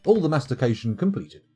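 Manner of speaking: ordinary delivery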